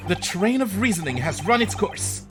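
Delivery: a hint of a smug sleuth; lightly voiced great detective. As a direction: deduce coolly, like a famous detective.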